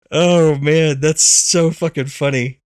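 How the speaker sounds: usual speaking voice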